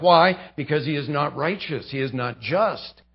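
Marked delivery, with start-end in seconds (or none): none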